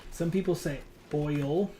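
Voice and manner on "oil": "oil" drawn out with a clearly sounded L; pronounced L. Shown as a demonstration. Player showing how some people say it, "oil" with a heavy L. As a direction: lean on the L in "oil", slowly. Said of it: "really pronounce the L".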